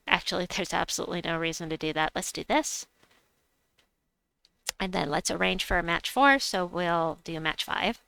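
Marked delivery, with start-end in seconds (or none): none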